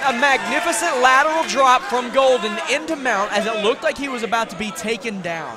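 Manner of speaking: high, loud excited call; excited play-by-play. Real, not acted. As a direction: ride the thrill of the move. Play-by-play on a big takedown.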